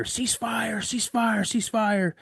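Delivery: high repeated call of ceasefire. Retold or imitated. Soldier calling ceasefire to the squad, shouted. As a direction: call it out across the range.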